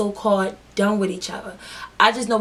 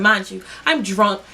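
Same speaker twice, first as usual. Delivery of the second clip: ordinary delivery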